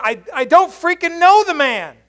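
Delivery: high, loud furious denial; cussing out. Retold or imitated. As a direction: swear him off at full volume. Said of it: "like, cussing out".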